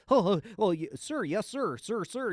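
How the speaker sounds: mock bootlicking junior officer; hammed-up sycophant; fawning officers. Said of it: "all these officers are just like".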